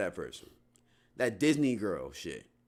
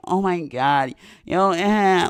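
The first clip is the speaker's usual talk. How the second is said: loud, high mock Valley Girl; 9 semitones higher, 11 dB louder, slower than usual. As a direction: push it up into an over-the-top Valley Girl. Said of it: "that Valley Girl shit".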